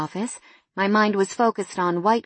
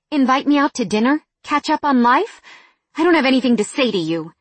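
louder, higher, rapid mocking cut-off; angry; 6 semitones higher, 5 dB louder than usual. A narrator's reading. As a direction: fire it back at him. Angry woman cuts off her ex.

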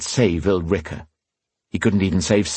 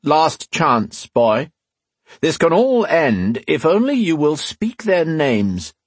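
last offer; 9 semitones higher, 3 dB louder, slower than usual. A narrator's reading. Leader's final offer.

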